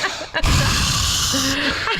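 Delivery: a long high exaggerated sigh; breathy sigh. Husband showing his wife the breath sigh. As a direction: heave a big showy sigh at her. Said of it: "And I go, I go like".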